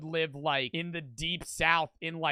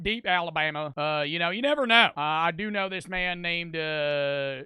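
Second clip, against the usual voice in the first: put-on deep Southern accent. Streamer playing a local gossiping about cousins, deep Southern accent. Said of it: "Let's hear a deep Southern accent".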